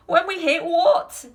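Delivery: high, loud, stretched-out question; alarmed cry. A narrator's reading. Passengers ask Wonka what they will hit, crying out. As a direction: cry it out, rising in disbelief. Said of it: "they cried".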